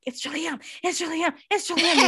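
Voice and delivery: building from high to loud; rising excitement. Retold or imitated. Teller rushes to tell her husband, building excitement.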